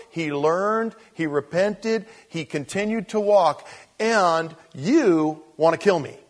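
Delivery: loud, rising preacher cadence; full-force preaching. Acted. Preacher pressing the crowd on Abraham, loud and rising. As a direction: preach it hard to the congregation.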